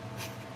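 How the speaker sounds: soft giggle at oneself; laughing. Real, not acted. Streamer amused at her own bad accent, real giggle. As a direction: giggle quietly, breath first.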